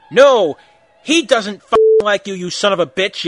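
loud, emphatic retort; hammed-up furious shouting; angry yell. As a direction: yell it at your rival. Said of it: "yelled Draco".

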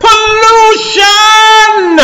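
huge, high stretched-out shout; drawn-out outcry. Acted. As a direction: hold the word long and loud, almost sung. Climactic cry in read-aloud story.

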